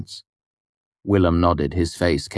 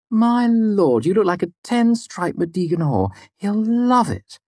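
high, loud gushing admiration; appreciative; 4 dB louder than usual. A narrator's reading. Devi looks Fela over in her dress, gushing and appreciative. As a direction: gush over her outfit, high-voiced. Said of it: "looking her over appreciatively".

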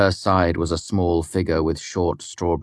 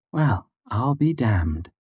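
higher, drawn-out sarcastic surprise; sharp sarcasm; 6 semitones higher, slower than usual. A narrator's reading. Devi reacts to the apology, sharply sarcastic. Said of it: "her voice sharp with sarcasm".

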